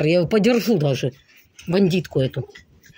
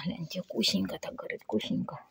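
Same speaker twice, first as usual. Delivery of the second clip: whispering; 3 semitones lower, 15 dB quieter than usual. Pretend. Voicing pet, whispered.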